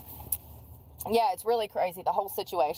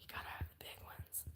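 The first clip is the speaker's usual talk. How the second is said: whisper; 20 dB quieter, faster than usual. Real, not acted. Whispered aside.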